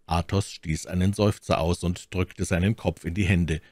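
ordinary delivery, nothing marked

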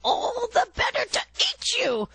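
very high, strained fake granny voice; the wolf in bed faking granny's voice. A narrator's reading. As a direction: squeak like a wolf pretending to be granny.

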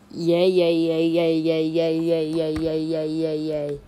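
loud run of yays, gradually easing off; pretend cheering. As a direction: chant a continuous cheer that starts loud and fades. Character cheering in play.

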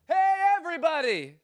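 high, ringing call to the whole crowd; yelling out. Retold or imitated. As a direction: call out to everyone who can hear. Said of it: "He was just yelling it out".

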